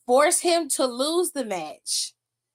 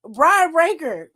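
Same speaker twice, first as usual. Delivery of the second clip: slow, louder name call-out; calling him out; 4 semitones higher, 5 dB louder than usual. Real, not acted. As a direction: call his name like a summons.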